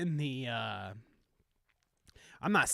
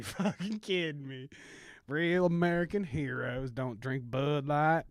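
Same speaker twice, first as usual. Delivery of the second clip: put-on Southern country accent. Drinker swearing off Bud Light, Southern country accent. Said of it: "country accent and my rendition of a Southern".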